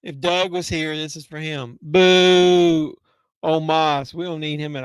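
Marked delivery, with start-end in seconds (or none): 1.8-2.9 loud drawn-out boo. Real, not acted. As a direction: boo him like a crowd. Booing the wrestler.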